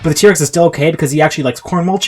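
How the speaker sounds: rushing, excited action narration; run-on battle play-by-play; hyped-up storytelling. A narrator's reading. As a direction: pile it on like an overexcited kid.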